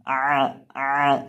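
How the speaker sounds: isolated आ squeezed from the middle throat; mid-throat ayn. Shown as a demonstration. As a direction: sound आ twice as an Arabic ayn. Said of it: "इसको आपने हलक के बीच से अदा करना है". (عین کی آواز حلق کے درمیان سے)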